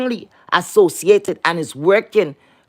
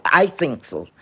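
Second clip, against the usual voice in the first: ordinary talk, nothing marked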